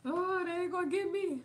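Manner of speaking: high-pitched pretend Jamaican accent; put-on Jamaican accent. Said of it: "I'm gonna put that accent on".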